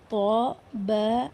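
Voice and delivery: طا and با drawn out very slowly with a bouncing stop; qalqalah letters. Shown as a demonstration. Teacher lists the qalqalah letters, very slowly. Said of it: "حروف قلقلہ پانچ ہیں".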